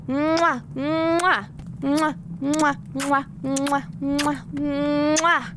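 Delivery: kisses. Real, not acted. Blowing kisses goodbye.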